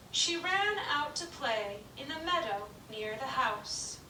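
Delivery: ordinary delivery, nothing marked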